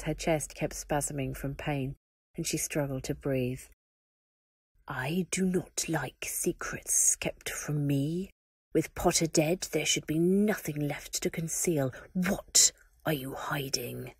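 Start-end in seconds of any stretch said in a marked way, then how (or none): 4.9-14.1 slow, cold hissing whisper; dark lord hissing at his captive; hissed. Acted. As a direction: menace her in a hiss. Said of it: "Voldemort hissed".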